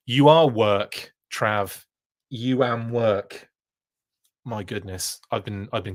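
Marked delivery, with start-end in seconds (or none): 0.1-1.8 "You are work" stretched out, x0.5 pace; slow joke praise. Real, not acted. Joking praise to Trav. 2.3-3.5 "You are work" stretched out, x0.5 pace; slow joke praise. Real, not acted. Repeats joke with wrong grammar.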